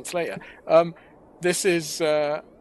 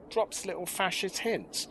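ordinary talk

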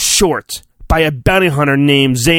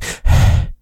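a long heavy breath out. Acted. Reading a storybook, a mock heavy breath. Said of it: "That breathing".

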